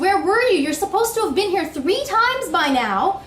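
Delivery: loud, high, fast furious yell at the driver; angry yell. Retold or imitated. Waiting passenger yelling at the late bus driver. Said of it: "I want to yell".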